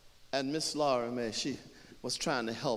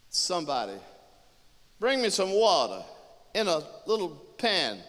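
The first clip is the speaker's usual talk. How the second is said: calling out; 6 semitones higher, 5 dB louder than usual. Acted. Woman calls out for water.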